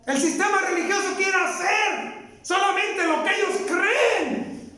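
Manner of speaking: high, shouted denunciation; preaching at full force. Acted. Preacher condemning the religious system, shouted sermon. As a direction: rail against the religious system.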